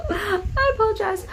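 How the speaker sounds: high, laughing apology. Real, not acted. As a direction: giggle through the words.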